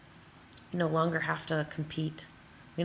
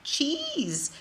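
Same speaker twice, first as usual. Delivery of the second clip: high, slow incredulous question; slow high mock-baffled self; quoted disbelief; 12 semitones higher, slower than usual. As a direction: ask it like he's lost his mind. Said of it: "And I'm like".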